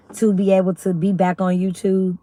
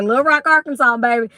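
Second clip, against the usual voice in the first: being funny with a put-on Southern accent; playful Southern twang; 4 semitones higher than usual. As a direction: lean into the Arkansas twang for a laugh. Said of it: "'cause I was being funny".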